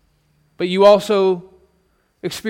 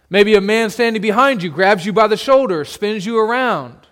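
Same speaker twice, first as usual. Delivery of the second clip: ordinary delivery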